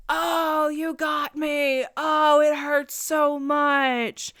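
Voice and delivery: hammed-up high, loud, slow mock pain; mock agony. Fool mocking the man he just hit, hammed-up agony. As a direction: overact being hit, sarcastically. Mock death after brick falls.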